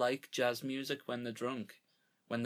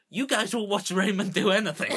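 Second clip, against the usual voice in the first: loud sitcom quote; 8 semitones higher, 11 dB louder than usual. Retold or imitated. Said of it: "And he goes".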